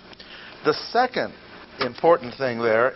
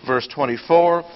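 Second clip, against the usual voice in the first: ordinary talk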